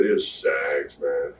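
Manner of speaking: low, soft, grim; quiet grim answer. Retold or imitated. Telling Kev who hit him, low and soft. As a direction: tell him who did it under your breath.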